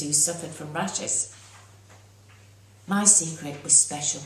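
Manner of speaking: ordinary delivery, nothing marked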